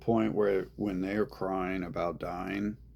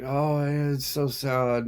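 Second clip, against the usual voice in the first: slow, high, louder mock sympathy; fake sympathy; 6 semitones higher, 5 dB louder, slower than usual. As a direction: pretend to be sad to comfort them. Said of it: "I'm just like".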